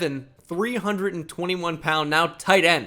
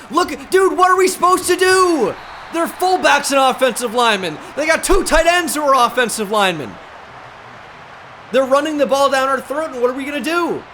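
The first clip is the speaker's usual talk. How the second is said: much higher and louder, pleading to a buddy; exasperated rant; 7 semitones higher, 5 dB louder than usual. Real, not acted. Gamer venting to his viewers about the offense, loud exasperated rant. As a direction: rant like you can't believe what you see.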